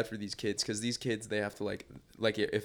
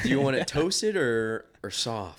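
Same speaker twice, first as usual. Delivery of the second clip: stingy parent voice; 3 semitones higher, 5 dB louder, slower than usual. Acted. Mocking stingy parent offering bread.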